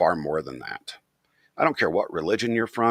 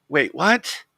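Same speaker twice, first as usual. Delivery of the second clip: surprised; 8 semitones higher, 6 dB louder than usual. Retold or imitated. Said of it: "And I'm like".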